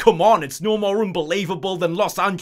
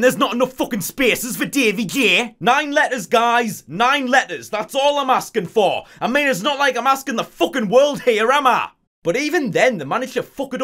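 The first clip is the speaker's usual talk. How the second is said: louder, higher frustrated rant; exasperated rant; 6 semitones higher, 3 dB louder than usual. Real, not acted. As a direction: vent about the name limit. Ranting about name limit.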